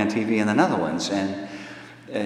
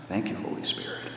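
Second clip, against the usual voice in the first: whispered thanks; 10 dB quieter than usual. Retold or imitated. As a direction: whisper of relief to the Holy Spirit. Grateful aside after answering.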